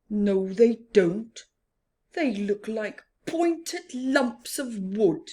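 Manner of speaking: loud fed-up complaint; complaining Rhino. Acted. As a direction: moan about your boring horns. Rhino answers grumpily.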